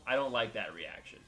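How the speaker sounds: usual speaking voice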